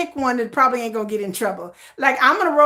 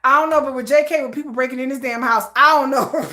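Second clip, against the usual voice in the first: ordinary talk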